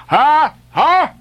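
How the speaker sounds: high, loud triumphant cry; excited cry. A narrator's reading. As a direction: cry out at what he spots. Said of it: "cried Daggoo".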